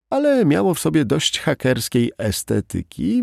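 ordinary delivery

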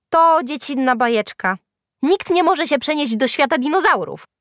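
put-on high, loud voice of a girl; scornful calling out. A narrator's reading. Classmate dismisses Julka's story, calling out. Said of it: "jakaś dziewczyna zawołała".

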